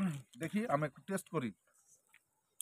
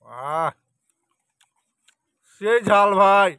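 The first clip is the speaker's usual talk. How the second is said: loud gasping through a burning mouthful; mouth full of hot food; 2 semitones higher, 14 dB louder, slower than usual. Real, not acted. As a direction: talk while the chili burns your mouth. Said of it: "ঝাল হয়ে গেছে ভাই".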